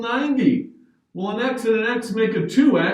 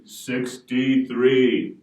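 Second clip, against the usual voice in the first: lower, slow echo of sixty-three; 8 semitones lower, much slower than usual. Shown as a demonstration. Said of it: "echo of the answer".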